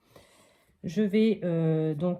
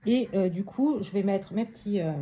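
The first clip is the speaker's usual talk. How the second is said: usual speaking voice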